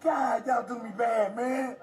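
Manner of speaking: slow, loud anguished cry; anguished shout. Real, not acted. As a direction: shout it out in real despair. Man cries out at officers.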